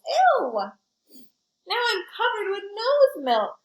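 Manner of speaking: high, loud grossed-out squeal; disgusted. Acted. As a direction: recoil from the nose milk, high and loud. School covered in milk, disgusted.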